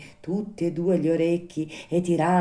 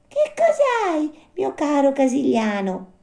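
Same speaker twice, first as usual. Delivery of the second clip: caring marmot; 13 semitones higher, 5 dB louder than usual. A narrator's reading. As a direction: ask with concern in a high, tiny marmot voice. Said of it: "domandò premurosamente".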